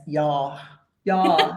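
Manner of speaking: yeah said as "yaw", rounded vowel; imitating her South African "yeah", like "yaw". Host mimics guest's South African "yeah" as "yaw". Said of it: "I love how you say yes".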